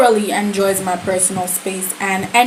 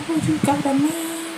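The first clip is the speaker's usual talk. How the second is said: high, softer mimicking voice; mock surprised question, pitched high; mocking imitation; 7 semitones higher, 5 dB quieter than usual. Mimicking Sab's reply to the news.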